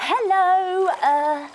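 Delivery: high, bright greeting; cheery hello. Pretend. Yoga leader greeting the earth, high and bright. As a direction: sing out hello to the ground. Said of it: "we say hello to the earth".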